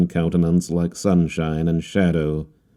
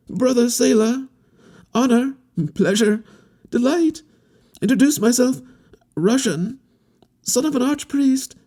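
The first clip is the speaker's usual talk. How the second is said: much higher voice, tumbling broken phrases; gabbling. A narrator's reading. Harlequin gabbling his introduction while shaking hands, high and rapid. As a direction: babble out an eager introduction. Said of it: "while he gabbled".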